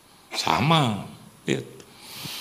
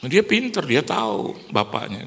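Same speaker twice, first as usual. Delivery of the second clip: ordinary delivery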